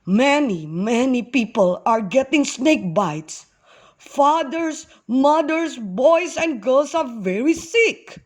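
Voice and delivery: put-on tearful elderly man. A narrator's reading. Elderly man reporting the sick to Moses, mildly tearful distress. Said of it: "with tears in his eyes".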